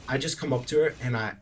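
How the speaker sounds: ordinary talk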